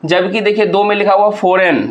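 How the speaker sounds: usual speaking voice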